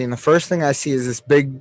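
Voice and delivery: usual speaking voice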